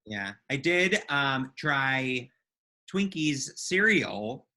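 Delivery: ordinary delivery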